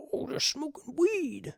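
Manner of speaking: high, slow mocking old person. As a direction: mimic a clueless old person. Mocking old people's reaction.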